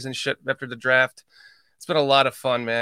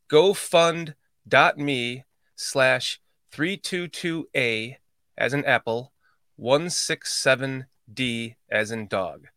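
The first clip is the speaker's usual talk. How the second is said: slow, careful dictation; slower than usual. Shown as a demonstration. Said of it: "I'll read that one more time".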